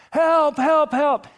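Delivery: high, loud mock distress cries; calling for help. Swimmer in difficulty at the pool, crying out for help. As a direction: shout it repeatedly like someone going under.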